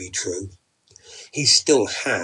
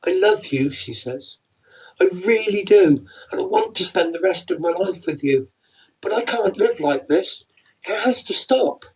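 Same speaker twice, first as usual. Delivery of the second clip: close to tears; 8 semitones higher, 4 dB louder than usual. Acted. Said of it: "She's close to tears".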